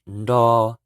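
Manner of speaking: "ndo" loud with N before D; teacher drilling nr syllable; Hmong nro. Shown as a demonstration. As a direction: clearly say "ndo". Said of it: "N R is pronounced".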